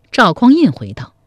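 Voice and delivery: ordinary delivery, nothing marked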